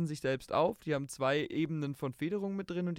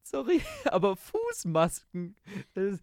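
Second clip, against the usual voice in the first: laughing; 6 semitones higher, 4 dB louder than usual. Real, not acted. Laughing at foot masks.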